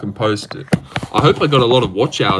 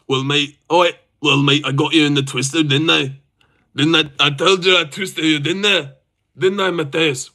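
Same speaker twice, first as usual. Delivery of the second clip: put-on Scottish accent at natural pitch. Streamer imitating a fighter gloating to Matthias, Scottish accent. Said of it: "Scottish accent, by the way".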